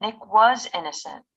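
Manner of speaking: was made long and strong; stress on was. Shown as a demonstration. Textbook example line, stress on was. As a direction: lean on was. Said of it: "we can emphasize it in the same way".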